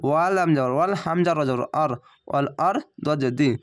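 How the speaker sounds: ordinary delivery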